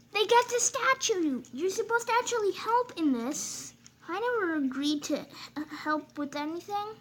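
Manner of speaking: high-pitched squeaky character voice; high engine voice. Acted. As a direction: play the indignant little engine.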